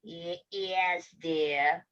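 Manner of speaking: low, soft, scratchy fake grandma; wolf posing as an ailing granny; feeble, scratchy reply from bed. A narrator's reading. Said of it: "came a scratchy voice from the bedroom".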